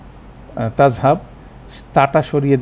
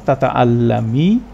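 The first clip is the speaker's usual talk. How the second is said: slow recitation; slower than usual. Shown as a demonstration. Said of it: "lightest করলাম".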